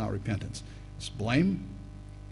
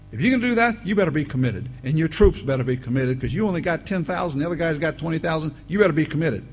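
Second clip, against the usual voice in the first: loud, insistent pulpit push; preacher drives home total commitment, louder; fiery preaching; 5 semitones higher, 6 dB louder, faster than usual. Acted. Preaching builds to full force.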